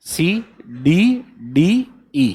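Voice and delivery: C D D E spelled out letter by letter, very slow; slow dictation. Shown as a demonstration. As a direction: spell it out slowly so students can memorise it. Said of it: "simple वे में याद करना है". (धीरे-धीरे अक्षर बोलते हुए)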